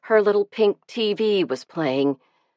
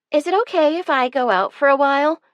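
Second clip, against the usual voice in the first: casual; 8 semitones higher, faster than usual. A narrator's reading. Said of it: "she asked casually".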